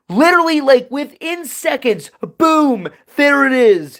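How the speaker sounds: high, loud burst of amazement; excited reveal. Real, not acted. Streamer telling viewers about the train's sudden arrival, excited. Climax of a surprising train story.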